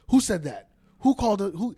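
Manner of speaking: loud demanding who said it; angry yell. Retold or imitated. Guard yelling at travelers, angry. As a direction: bark the question like a furious guard. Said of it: "this guy lost his fuck".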